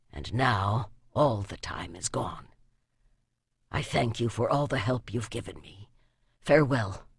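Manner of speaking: soft, low murmured farewell; hushed farewell. A narrator's reading. Lonely archer thanking his goddess, soft and low. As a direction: say goodbye to the goddess under your breath. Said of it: "he whispered".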